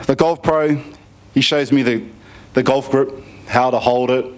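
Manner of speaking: ordinary talk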